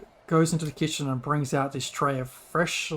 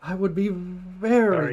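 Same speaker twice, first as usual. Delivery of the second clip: garbled, as if underwater; slow, muffled call dropout; underwater audio; 4 semitones higher, 3 dB louder, slower than usual. Real, not acted. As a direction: voice warbling through a bad connection. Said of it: "like you were underwater".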